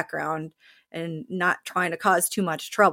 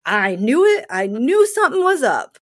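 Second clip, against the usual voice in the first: higher and louder, catching her out; excited, much higher I-knew-it; gleeful gotcha; 6 semitones higher, 6 dB louder than usual. Retold or imitated. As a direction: crow it like you caught her lying. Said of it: "And he's like".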